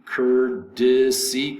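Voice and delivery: very slow, syllable by syllable "courtesy", cur-di-see. Shown as a demonstration. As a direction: spell out "courtesy" by sound with a D in the middle. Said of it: "the T is pronounced like a D".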